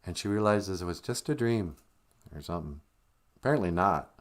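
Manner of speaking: ordinary delivery, nothing marked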